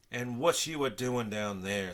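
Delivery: a gruff station porter, suspicious and slow. A narrator's reading. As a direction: eye them with suspicion as you ask. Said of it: "said Mister Grumble suspiciously".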